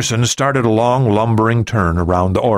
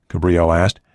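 ordinary delivery

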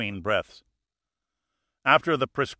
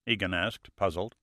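usual speaking voice

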